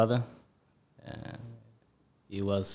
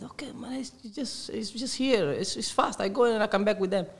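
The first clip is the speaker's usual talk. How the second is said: put-on pleading boy; 10 semitones higher than usual. Boy persuading his mother it is near, pleading. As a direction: plead your case in a small, high voice.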